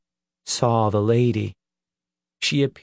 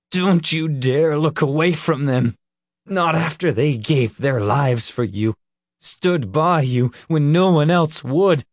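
angry shout; 4 semitones higher than usual. A narrator's reading. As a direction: demand it in fury. Nick furious at Peter.

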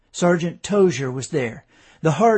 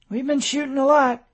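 much higher, defensive; defensive reply; 10 semitones higher, 4 dB louder than usual. A narrator's reading. Tom answers the colonel about ammunition, defensive and high. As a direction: pitch it up like a defensive subordinate.

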